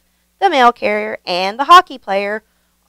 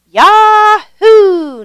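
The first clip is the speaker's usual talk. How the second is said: much higher, loud whooping yell; joyful yell; 11 semitones higher, 10 dB louder than usual. A narrator's reading. Nikki whooping again as they rush downhill, loud and high. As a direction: whoop with delight. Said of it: "Nikki yelled again".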